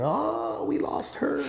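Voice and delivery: high-pitched retold wail of grief; mock wailing scream. Retelling grandma's funeral, imitating wailing women, high mock wail. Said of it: "the women are screaming".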